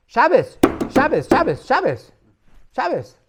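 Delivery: high, very loud repeated shouts; angry outburst. Retold or imitated. Rabbi cutting off the mocking jester, outraged shout. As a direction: cry out in outraged protest. Rabbi angrily interrupts the joke.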